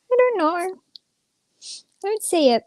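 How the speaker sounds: ordinary delivery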